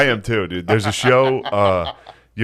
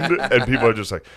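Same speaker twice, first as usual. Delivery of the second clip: ordinary talk, nothing marked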